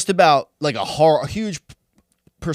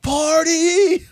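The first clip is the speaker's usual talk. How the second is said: high, wild party cheer; party shout; 14 semitones higher, 4 dB louder than usual. Retold or imitated. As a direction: yell it like a carefree teen. Mocking carefree teen partying.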